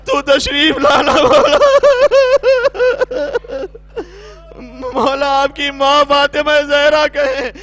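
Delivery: loud pleading sermon cry to Mola; impassioned preaching. Acted. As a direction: call out to Mola with full force. Preacher pleads to Mola at full force. (پورے جوش سے بلند آواز میں مولا کو پکارنا)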